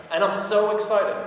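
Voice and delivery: high, loud, stretched enthusiasm; excited. Shown as a demonstration. Seller hooks the client's curiosity, excited. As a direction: lean hard and slow into "so excited". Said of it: "when I say the word excited".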